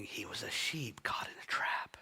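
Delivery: hushed, whispered line; preacher whispering the heart of the story. Real, not acted. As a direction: breathe it softly to the room. Tender sermon climax.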